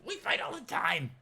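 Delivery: high-pitched character voice; eager Scooter. Acted. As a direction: agree in a high, cackly sidekick voice. Scooter agreeing to fake fight.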